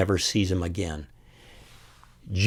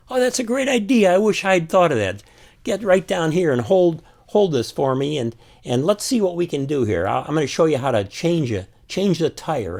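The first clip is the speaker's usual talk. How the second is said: eager helpful father; 8 semitones higher than usual. Retold or imitated. Father invites Ralphie to help change the tire, hammed-up glee. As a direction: welcome the boy in like it's the best idea ever. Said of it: "He said".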